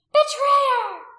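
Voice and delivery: high, full-voiced outraged shout; furious cry. A narrator's reading. Priestess crying out at the man who betrayed her, furious. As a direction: hurl the accusation at full volume. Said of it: "Anomia cried out at him, eyes blazing".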